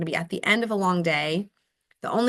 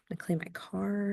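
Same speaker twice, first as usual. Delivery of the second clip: ordinary talk, nothing marked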